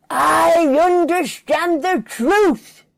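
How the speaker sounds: slow, high, loud proclamation; dawning revelation. Acted. Joy announcing to Dillard her slow high revelation. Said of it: "Joy realised".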